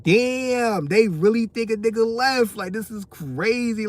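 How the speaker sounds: mock outrage. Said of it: "I'm like".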